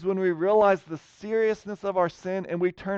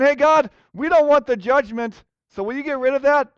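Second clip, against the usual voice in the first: higher, louder whiny plea; mocking plea; 7 semitones higher, 6 dB louder than usual. Israel pleading with God to drop judgment, higher, louder mock whine. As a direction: brush off God like a nuisance. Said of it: "They're saying".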